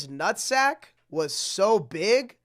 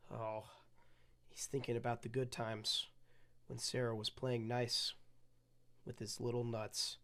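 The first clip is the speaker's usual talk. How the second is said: pitying aside; 5 semitones lower, 9 dB quieter, faster than usual. Real, not acted. Pitying aside over picture.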